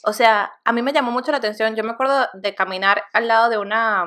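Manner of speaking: normal talking voice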